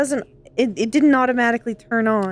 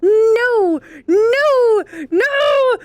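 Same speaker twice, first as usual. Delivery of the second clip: louder, higher rising no's; each no higher and more desperate; loud despairing no; 7 semitones higher, 5 dB louder, much slower than usual. Real, not acted. Reacting to huge bomb gift.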